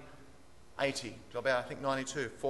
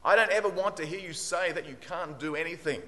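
firm, raised teacher's voice; lightly acted telling-off, louder and higher; stern retold scolding; 4 semitones higher, 5 dB louder than usual. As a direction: lay down the law, mildly. Strict teacher scolding in retold story.

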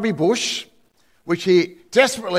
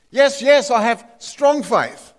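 higher, urgent insistence, slow on the last words; eager desperate yes; 6 semitones higher, 3 dB louder, slower than usual. Acted. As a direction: swear your faith, pleading. Desperate man assures God.

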